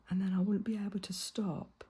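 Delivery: normal talking voice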